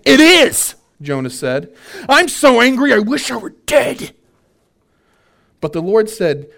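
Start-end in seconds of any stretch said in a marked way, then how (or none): 0.0-0.8 high, loud, sharp answer; defiant retort. Retold or imitated. Jonah answering God, high loud defiant. 2.1-4.1 higher, louder petulant outburst; mock anger. Jonah complaining to God, loud high petulant anger.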